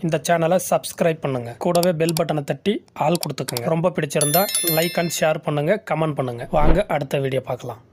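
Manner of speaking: low, steady subscribe-and-like pitch; promo outro read. Acted. Host asking viewers to subscribe, in a low announcer read. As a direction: read the channel sign-off like an announcer.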